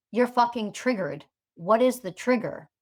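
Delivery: ordinary delivery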